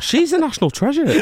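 high, mock-indignant voice through laughter; indignant viewer. Singer imitates viewers defending Lorraine, mock-indignant.

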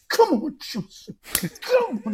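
high-pitched strained urging, mimicking a wound-up fan; about to explode. Fan imitates intense bloke cheering on Chelsea, about to explode. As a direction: act out a fan bursting with intensity. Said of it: "his whole body was about to explode".

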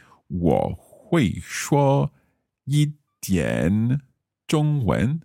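slow, syllable by syllable 我会说一点中文; tentative learner trying out 我会说一点中文; careful learner's Mandarin. Shown as a demonstration. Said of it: "so could I say".

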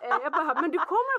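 laughing through the retelling. Real, not acted. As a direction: tell it while laughing. (skrattande berättande)

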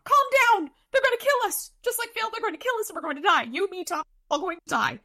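very high, hysterical squeal; hammed-up high-pitched panic; panicked Butters. A narrator's reading. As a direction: shriek it in rising hysteria, easing slightly by the end.

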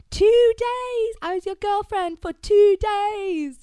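a hammed-up furious rant at the ex; mock outraged outburst. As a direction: play the wronged girlfriend letting rip. Quoting Anna's outburst.

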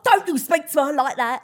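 loud, protective scolding; defensive telling-off. Retold or imitated. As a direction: stand up for her firmly. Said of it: "my other friend who worked with me was like".

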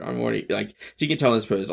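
ordinary delivery

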